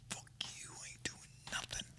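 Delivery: voiceless, very quiet whisper; whispered refusal. Retold or imitated. Answering a friend who offers shrooms, whispered refusal. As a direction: whisper the refusal as an acted-out reply.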